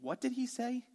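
high, baffled whisper to the other guys; confused friend on the roof. Retold or imitated. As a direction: friend on the roof asking what Jesus said, confused. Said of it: "I'm looking at the other guys going".

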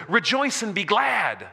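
loud, high exultant call to rejoice; joyful proclamation. Retold or imitated. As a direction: proclaim it like good news. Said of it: "He goes like this".

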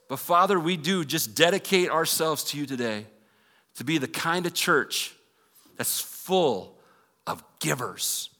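earnest public prayer, stretched key phrases; congregational prayer. Real, not acted. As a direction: lead the church in fervent, building prayer.

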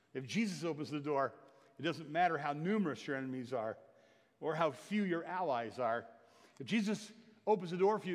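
loud, high preacher's climax, building; full-force preaching. Acted. Pastor stirring the church, loud and high preaching. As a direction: lift it to a ringing shout of faith.

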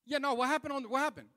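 loud, high-pitched prying; eager brother. Retold or imitated. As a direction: imitate a brother pressing loudly for gossip. Brother on phone pressing him.

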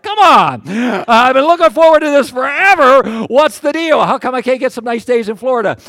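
loud, high mock complaint; hammed-up indignant protest at full volume; exasperated outburst. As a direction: cry out in exaggerated frustration. Acts out his frustrated reaction.

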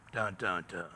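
soft, low mock suspense sting; quiet ominous three-note cue; dramatic dun dun dun. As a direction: hum it like a movie reveal.

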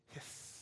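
soft voiceless snake hiss; breathy imitated hiss. Snake hiss between taunts.

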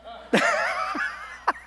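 high, wheezing laughter; helpless laugh. Real, not acted. Father watching his frustrated son, helpless laughing. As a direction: crack up mid-story.

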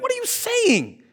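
high, baffled disciple voice; mock bewildered disciples; confused disciples. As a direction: play the puzzled followers. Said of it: "And they're like".

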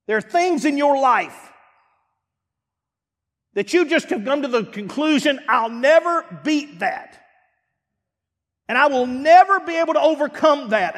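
preacher voicing the listener's hopelessness; rising preaching. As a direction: build the despair to a peak. Preaching build.

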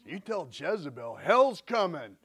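building to a loud, slow hell's coming; defiant threat. Retold or imitated. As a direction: swell up and slam hell's coming slowly. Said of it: "I'd be like".

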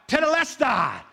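Tetelestai cried out high and loud. Retold or imitated. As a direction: call Tetelestai out from the cross. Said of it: "he cried out".

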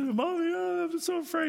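put-on high childlike voice, afraid; scared little girl. Little girl in thunderstorm telling mommy she is scared, high and afraid. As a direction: squeak it up like a frightened small child. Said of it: "little girl that was crying".

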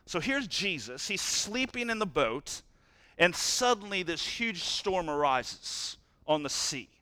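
normal talking voice

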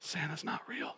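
hushed, voiceless whisper; mock whisper. Dad answers daughter about Santa, joking whisper. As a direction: whisper it like a forbidden secret, jokingly.

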